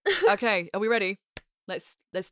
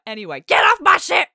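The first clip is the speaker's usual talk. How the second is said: sudden shouted outburst, higher and louder; deranged shout; 10 semitones higher, 10 dB louder than usual. Retold or imitated. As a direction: snap from normal into a deranged yell. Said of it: "she'll just be like".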